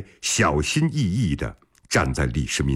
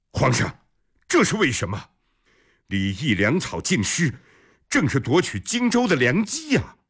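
higher-pitched, startled protest; astonished; 7 semitones higher than usual. A narrator's reading. General questioning the emperor's decision on Jingzhou, astonished. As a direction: question it in raised-pitch surprise. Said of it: "尉迟敬德一脸惊讶".

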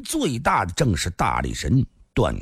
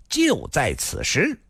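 slow, stern, rising higher; 6 semitones higher, slower than usual. A narrator's reading. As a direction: hand down the words sternly and slowly. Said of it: "厉声地说道".

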